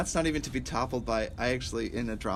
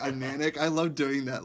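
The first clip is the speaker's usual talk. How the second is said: normal talking voice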